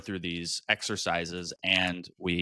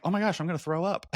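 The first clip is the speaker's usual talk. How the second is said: quick, higher panicked aside; panicked retelling; 9 semitones higher, faster than usual. Retold or imitated. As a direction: blurt it out fast in mock panic. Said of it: "and then I'm like".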